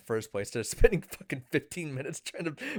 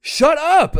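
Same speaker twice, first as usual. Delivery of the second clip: loud, much higher exasperated yell; angry shout; 13 dB louder than usual. Real, not acted. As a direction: yell at him to stop interrupting. Friend keeps interrupting, laughing.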